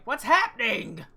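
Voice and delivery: loud, high, panicked question; startled shout. Acted. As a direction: cry out as you hit the snow. Character falls face first in snow.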